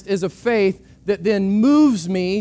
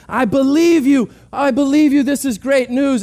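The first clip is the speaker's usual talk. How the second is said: higher, louder, earnest delight; eager believer; 5 semitones higher, 5 dB louder than usual. Retold or imitated. Desperate person answering the park ranger, joyful and louder. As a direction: say it like you finally believe.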